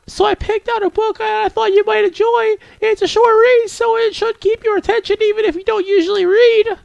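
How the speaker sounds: put-on very high-pitched girl character; high, girlish, tentative explanation; shy bookish girl. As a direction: offer it timidly in a falsetto anime voice. Voices a shy game girl.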